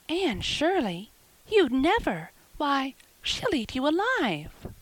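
can't believe her friend would dare; astonished. Acted.

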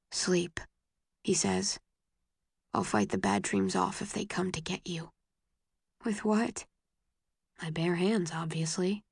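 gentle, hushed and soft; soft bedtime murmur. A narrator's reading. Boyfriend soothing her to sleep, soft murmur. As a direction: murmur close, tender. Bedtime whispers between lovers.

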